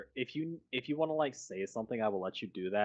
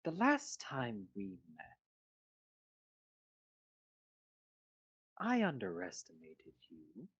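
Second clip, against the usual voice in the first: cold villain voice; 4 dB quieter than usual. Acted. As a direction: purr the contempt like a polite villain. GM voices Urka.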